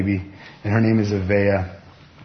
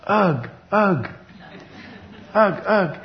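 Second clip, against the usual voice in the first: put-on higher toddler calls with little grunts; toddler hug grunts; 9 semitones higher, slower than usual. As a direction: squeeze out baby grunts. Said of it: "she has this habit of saying this".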